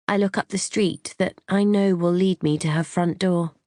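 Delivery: plain reading voice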